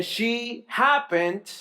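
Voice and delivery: "happened" drawn out slowly, pitch lifted at the end; stress on HAPPENED. Shown as a demonstration. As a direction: linger on the word "happened" and leave it hanging. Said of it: "I wanna emphasize this word".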